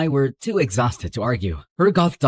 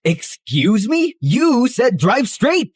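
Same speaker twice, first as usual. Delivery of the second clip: higher, louder, slow outraged protest; indignant protest; 7 semitones higher, 3 dB louder, slower than usual. Acted. William protesting Dee's sudden order, indignant. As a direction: stretch it out in disbelief. Dee suddenly changes the plan.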